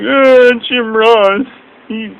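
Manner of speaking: high, slow, drawn-out whine; mock whining. Mocking Michael Cole whining about Jim Ross, slow high whine. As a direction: whine like a sulking child. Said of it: "he's just whining".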